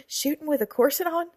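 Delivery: high, loud, incredulous question with a laugh; laugh, then ask it in amused disbelief. A narrator's reading. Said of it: "laughed the page".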